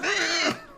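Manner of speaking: high-pitched imitated cat yowl; squirming cat cry. Game master as squeezed cat, high protesting yowl.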